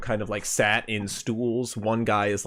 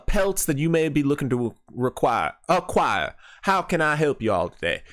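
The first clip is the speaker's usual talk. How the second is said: high, hearty drawling hunter-woman voice; folksy frontier woman; 5 semitones higher than usual. Acted. GM voicing the lodge owner greeting the party, hearty high folksy voice. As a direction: greet the travelers like a friendly backwoods proprietor.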